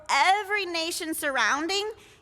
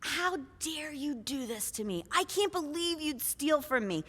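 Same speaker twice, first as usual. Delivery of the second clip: hushed accusation; 7 dB quieter than usual. Acted. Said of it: "he's like".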